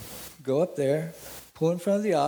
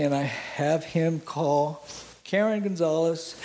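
usual speaking voice

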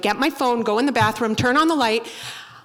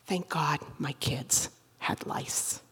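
hushed relieved whisper; 8 semitones lower, 11 dB quieter than usual. Retold or imitated. Comedian telling the crowd, slow relieved whisper. Relieved aside in panic story.

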